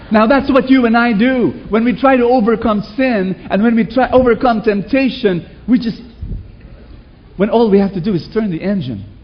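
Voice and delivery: preacher raising his voice to the crowd; preaching. Real, not acted. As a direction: drive the point home to the congregation. Preaching point.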